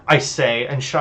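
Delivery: usual speaking voice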